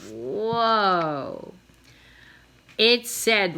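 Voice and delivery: usual speaking voice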